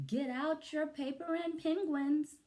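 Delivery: high teacher voice; silly teacher voice. Acted. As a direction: play the teacher high and sing-song. Teacher voice in read-aloud.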